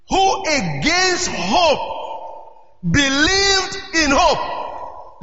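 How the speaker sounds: drawn-out, weighty scripture reading; slow emphatic reading. Acted. Pastor reading the key verse to the congregation, slow and weighty. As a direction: savour every word of the verse.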